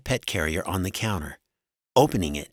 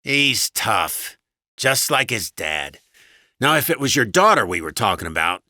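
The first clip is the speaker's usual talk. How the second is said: louder, boastful husband voice; slightly raised, callous husband; gruffly dismissive husband; 4 semitones higher, 5 dB louder than usual. A narrator's reading. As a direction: goad her as a blustering husband.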